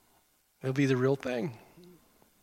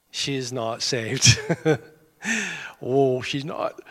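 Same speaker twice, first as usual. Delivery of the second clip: deadpan joke; much slower than usual. Real, not acted. Joking about checker not saved.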